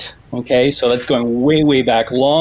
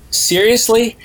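surprised question; 8 semitones higher than usual. Retold or imitated. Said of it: "Joshua Jackson goes".

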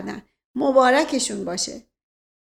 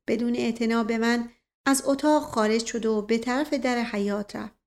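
plain reading voice

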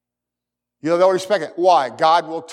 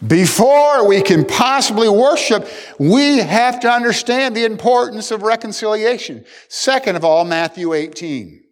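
ordinary talk, nothing marked

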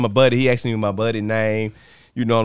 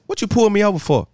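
higher-pitched snappy retort, retold; real snappy; 8 semitones higher than usual. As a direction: challenge him, mildly snappy. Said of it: "I got real snappy".

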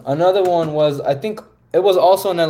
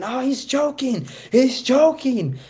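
mocking kids; 10 semitones higher, slower than usual. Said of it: "everyone started pointing and laughing at me, saying".

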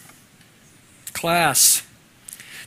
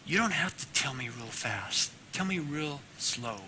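softer, gentle fatherly reply; tender father; 10 dB quieter than usual. Retold or imitated. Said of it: "And he goes".

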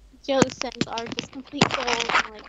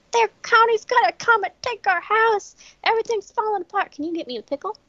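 louder, higher mock yell into a pickle request; retold yelling; 8 semitones higher, 5 dB louder than usual. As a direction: play the frantic hoarder shouting, then deflate. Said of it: "he's yelling".

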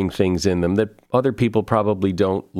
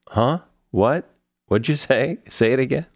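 short, higher-pitched questions, lightly acted; mock puzzled asking; 5 semitones higher than usual. Host voicing people who missed what was said, mildly puzzled. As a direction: imitate people who didn't catch it.

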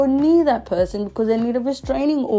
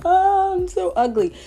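much higher, loud anguished wail; mock dream horror; 11 semitones higher, 4 dB louder than usual. In the dream after losing her teeth, mock horror. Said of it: "I was like".

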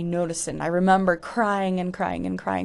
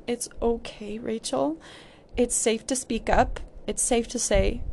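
gentle reassurance; 4 semitones higher than usual. Pretend. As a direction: speak as to a frightened child. Comforting her younger self.

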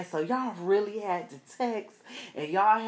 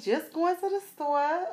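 much higher, sassy answer; playful ratchet attitude; ratchet sass; 10 semitones higher than usual. Retold or imitated. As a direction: put it on for him. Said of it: "And I was like".

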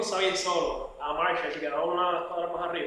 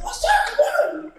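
very high mocking "what's up"; imitated street guy; 4 dB louder, much slower than usual. Guy at the crosswalk challenging the other man, high mock call. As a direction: toss it out like a menacing stranger. Stranger quoted again.

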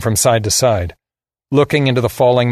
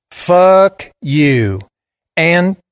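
higher, louder southern defiance; southern drawl; 8 semitones higher, 5 dB louder, much slower than usual. A narrator's reading. Boy curses the man about to fire, defiant southern drawl. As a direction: throw it at him in a Southern drawl. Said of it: "He had a deep southern drawl".

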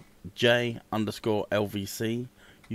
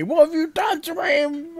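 high shrieking mum voice, much higher; mock angry mum; 5 dB louder than usual. Streamer imitating his partner's high shriek at him. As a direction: squeal it like a cross mother.

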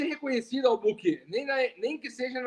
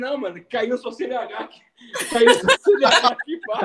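laughing through quote; 7 dB louder than usual. Retold or imitated. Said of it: "Ela falou assim".

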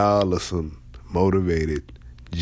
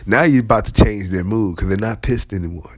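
ordinary delivery

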